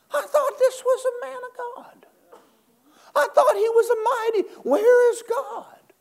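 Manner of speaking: high, crying comic lament; mock whining. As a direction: ham up a whiny complaint. Preacher mimics whining doubter.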